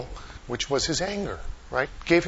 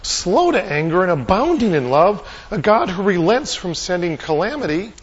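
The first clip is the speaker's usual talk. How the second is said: loud, slightly raised, mildly indignant complaint to God; acted angry appeal, pushed louder; angry prayer; 4 semitones higher, 6 dB louder than usual. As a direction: pray it as a forceful protest. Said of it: "He's angry at God".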